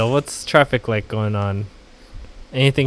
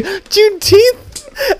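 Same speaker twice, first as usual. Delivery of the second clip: mock confused voice; 6 dB louder, much slower than usual. Mocking imagined listeners again.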